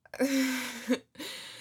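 drawn-out giggle at oneself; real laugh, rising at the end; self-amused laugh. Real, not acted. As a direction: laugh at your own new obsession. Laughs at herself.

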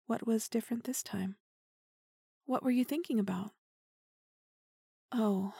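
usual speaking voice

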